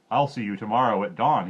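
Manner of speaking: loud, curt king; a greedy king dismissing the girl; king's order. A narrator's reading. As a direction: say it as a warning. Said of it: "he said".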